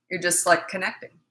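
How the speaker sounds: ordinary delivery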